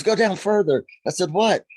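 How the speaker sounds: loud, high, insistent; sharp high call. Retold or imitated. Retold exchange with the fairy.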